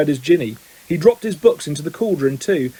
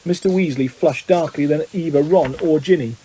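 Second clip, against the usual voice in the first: plain reading voice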